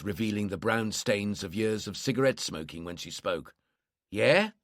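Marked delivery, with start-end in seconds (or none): 4.1-4.5 wary South London "yeah", rising; lightly voiced South London woman, suspicious; suspicious South London accent. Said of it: "suspiciously in a thick South London accent".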